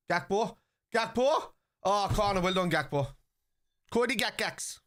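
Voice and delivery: rising, eager call of the name; urging on. Real, not acted. Streamer urging Gakpo on during the attack, excited. Cheering player in match.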